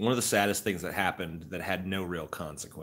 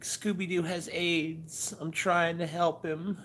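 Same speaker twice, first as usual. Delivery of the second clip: high, slow dreamy sleep-talk; imitating wife sleep-talking; 13 semitones higher than usual. Husband imitating his wife talking in her sleep, high and slow. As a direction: sadly mumble it half asleep. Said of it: "She's like".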